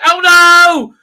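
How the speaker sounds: high, loud mock shriek; hammed-up terror; frightened captain shrieking in mock panic; panicked shriek. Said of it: "you're pissing yourself the first time".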